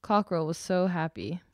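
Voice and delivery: plain reading voice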